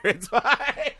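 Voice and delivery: high-pitched, laughing through the words; laughing reassurance. Retold or imitated. Retelling telling the nervous delivery guy to throw it, laughing reassurance.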